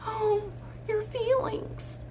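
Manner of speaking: high, soft, slow mocking coo; mock sympathy. Coach mocking clients who want comfort, sarcastic coo. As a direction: coo it like pitying a baby.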